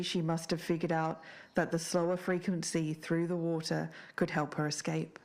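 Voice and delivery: ordinary talk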